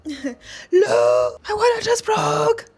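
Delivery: high, loud panicked blurt to her husband; telling her husband in a high, loud panic that her water broke; alarmed announcement. Retold or imitated. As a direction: sound the alarm, voice shooting up.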